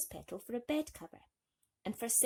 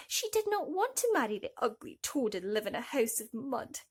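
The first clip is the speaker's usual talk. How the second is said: usual speaking voice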